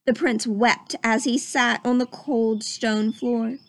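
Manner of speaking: usual speaking voice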